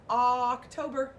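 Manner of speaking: slow clear teacher model; drawn-out classroom model; slow model word. Shown as a demonstration. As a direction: say it slowly for the class to repeat. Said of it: "Everybody say that".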